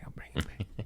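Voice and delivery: whispered, no voice at all. Shown as a demonstration. Whispering a promise to the host to steer it back. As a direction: drop to a breathy whisper on the repeat.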